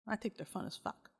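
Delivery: ordinary delivery, nothing marked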